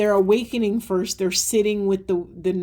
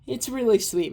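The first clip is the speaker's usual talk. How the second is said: ordinary talk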